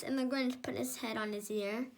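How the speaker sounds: plain reading voice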